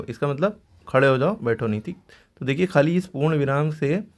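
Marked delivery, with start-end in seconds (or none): none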